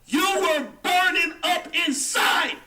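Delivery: slow, shouted taunt. Acted. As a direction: drag it out, mocking her pain. Taunting her hidden jealousy.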